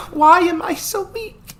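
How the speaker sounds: high, loud whimpering cry; a grieving girl crying out. Acted. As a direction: wail it from her knees. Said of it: "she whimpered".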